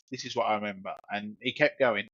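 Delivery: ordinary delivery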